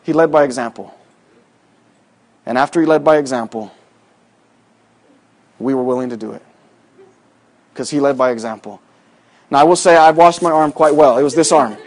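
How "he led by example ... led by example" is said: preaching. Acted. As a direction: drive the lesson home, softer each time. Preaching moral, slowly.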